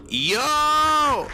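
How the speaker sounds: high, loud yell of triumph; excited shout. Real, not acted. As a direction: shout it out like you've just found it. Shouting on finding the station.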